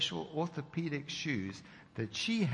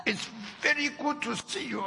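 tearful; 12 semitones higher, 4 dB louder than usual. Retold or imitated. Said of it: "she started to cry".

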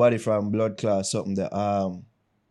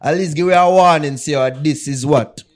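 louder and higher, fed-up venting; exasperated rant; 6 semitones higher, 8 dB louder than usual. Real, not acted. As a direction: rant about the group chat. Ranting about explicit videos sent.

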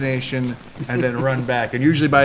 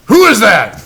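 loud, higher call into the dark yard; suspicious call out; 8 semitones higher, 8 dB louder than usual. Retold or imitated. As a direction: shout it out like a wary homeowner. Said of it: "And I hear".

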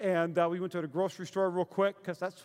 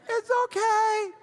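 high-pitched, slow syrupy reassurance; hammed-up sweet voice, drawn out; mock forgiveness; 13 semitones higher, 4 dB louder, slower than usual. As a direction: imitate someone suddenly all forgiving. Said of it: "She went from angry to".

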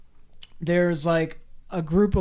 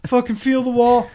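urging call; 4 semitones higher, 6 dB louder than usual. Retold or imitated. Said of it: "Be like".

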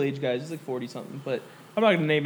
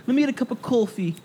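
higher, mock Jersey "cawfee"; Jersey accent; 5 semitones higher than usual. Mimicking an annoying customer, in a higher Jersey accent. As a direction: imitate a Jersey woman saying coffee. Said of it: "'Cause I'm from Jersey, that's how we say it".

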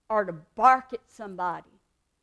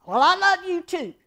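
higher, louder, sarcastic love you too; grudging love you; 7 semitones higher, 8 dB louder than usual. Shown as a demonstration. Answering someone's I love you with insincere sarcasm. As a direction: toss it off like you don't mean it. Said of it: "you don't feel love".

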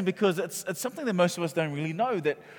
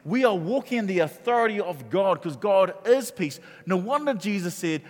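louder, higher, pressing preacher's cadence; full-force preaching; 4 semitones higher, 5 dB louder than usual. Acted. Pastor preaching to the congregation, loud and fervent. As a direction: drive the sermon home to the congregation.

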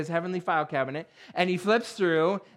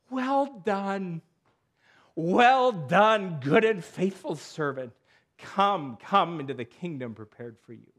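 solemn welcome; 3 dB louder than usual. Pretend. Jesus welcomes servant into kingdom.

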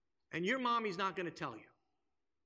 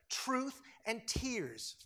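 slow refrain; 3 semitones higher, much slower than usual. Real, not acted. Sermon refrain.

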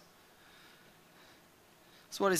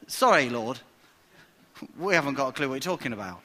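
sheepish admission; 6 semitones lower, faster than usual. Acted. Thomas admits confusion.

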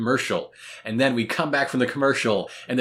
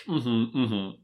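ordinary talk

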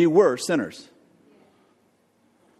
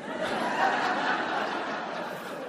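breathless laugh; 10 dB quieter than usual. Real, not acted. Audience-style laugh after joke.

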